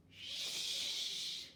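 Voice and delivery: whispered hush; class shushing. A narrator's reading. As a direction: hush the talker. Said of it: "said the rest of the class".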